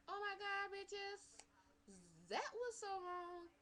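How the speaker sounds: high, drawn-out mock outrage.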